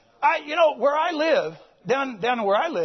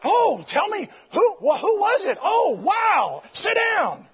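high, loud, astonished exclaiming; excited reaction; 6 semitones higher, 3 dB louder, slower than usual. Retold or imitated. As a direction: play the stunned pastor. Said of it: "then they're like".

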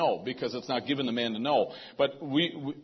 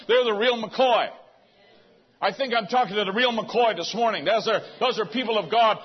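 high, loud fervent preaching; preaching at full force; 6 semitones higher, 5 dB louder than usual. Real, not acted. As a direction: build it up and shout it to the church. Preaching climax.

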